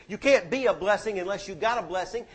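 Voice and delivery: normal talking voice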